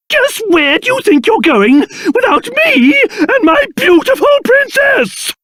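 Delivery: high-pitched outraged holler; indignant calling out. Acted. King left behind with his beautiful princess, indignantly calling out. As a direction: call after them, scandalised. Calls after departing companion.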